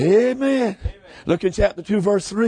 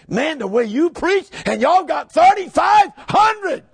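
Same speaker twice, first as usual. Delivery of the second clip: amazed outburst; 7 semitones higher, 3 dB louder, slower than usual. Retold or imitated. Retelling his amazed outburst.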